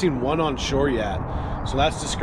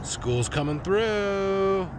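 drawn-out call, higher pitched; 5 semitones higher, much slower than usual. Real, not acted. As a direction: sing it out long on the last word. Calling out across the water.

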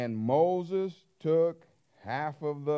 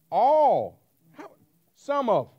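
mock cheer; 10 semitones higher, 10 dB louder, slower than usual. Mocking people's vow.

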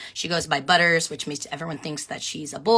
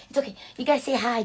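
normal talking voice

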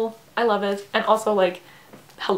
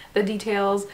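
normal talking voice

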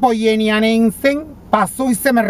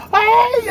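high wailing ay, stretched out slowly; dramatic high-pitched ay; retold cry of dismay; 13 semitones higher than usual. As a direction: cry out ay in mock horror at the wind. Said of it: "yo dije".

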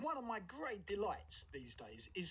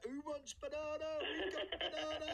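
put-on high, sing-song cockney barrow-boy cry; market trader's call; 9 semitones higher than usual. Remembering the market, calling out to shoppers like a barrow boy. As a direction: hawk your fruit like a street-market trader. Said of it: "so that I could shout".

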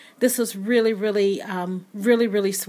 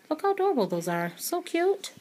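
high, gushing little squeal over the tiny keys; cutesy squeal; 7 semitones higher, 4 dB quieter than usual. Real, not acted. Crafter gushing to viewers over tiny wood veneer keys, high cutesy squeal. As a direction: coo at how adorable they are.

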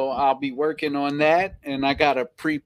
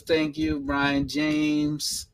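normal talking voice

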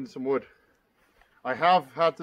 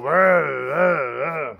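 loud, rough mock engine burble; imitating a four-stroking saw; 7 dB louder, much slower than usual. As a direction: sputter it out like a chainsaw running rich. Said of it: "That's my best impression of a four-stroking saw".

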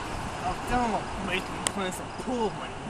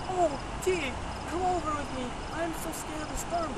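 higher, whiny scared woman voice; mocking his woman; 9 semitones higher than usual. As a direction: mimic her begging him to come over. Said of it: "She was like".

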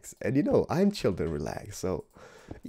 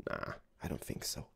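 whispering; 8 semitones lower, 12 dB quieter than usual. Acted. Hushed aside.

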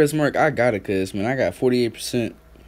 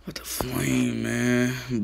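breathy whispered disbelief; 4 semitones lower, 6 dB quieter, much slower than usual. Real, not acted. Reading a viewer comment, whispering in disbelief. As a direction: hush it under the breath.

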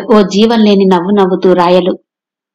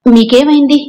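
sharp question; 5 semitones higher, 3 dB louder than usual. A narrator's reading. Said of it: "తీక్షణంగా అడిగింది".

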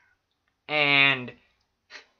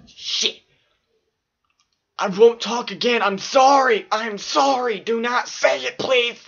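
panicked pleading; 6 semitones higher than usual. Retold or imitated. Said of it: "and I would go".

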